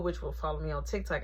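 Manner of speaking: ordinary talk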